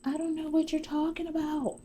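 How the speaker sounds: very high mock-clueless voice; feigned innocence. Busted Shayla denying everything to Arby, high mock innocence. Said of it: "Shayla gets this dumb look on her face".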